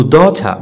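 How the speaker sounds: daughter as "daw-ta" with crisp T; British soft R. Shown as a demonstration. Teacher showing the British way, soft R. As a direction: end daughter on an open vowel, no R. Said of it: "اذا البريتش غنقولوا".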